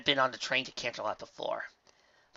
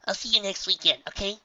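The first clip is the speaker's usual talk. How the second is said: put-on young filly voice; 9 semitones higher, 5 dB louder than usual. A narrator's reading.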